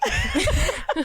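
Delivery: high-pitched burst of laughter; a short high giggle. Real, not acted. Laughing after joking clarification.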